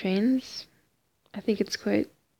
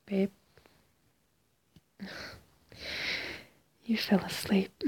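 soft whispered goodnight; pillow talk; 3 dB quieter than usual. Real, not acted. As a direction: whisper tenderly to a sleeper. Partner fell asleep, soothing goodnight.

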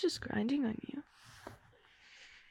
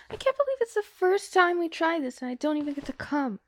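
upset complaint; 3 semitones higher, 9 dB louder than usual. Acted. Girlfriend complaining about missing out.

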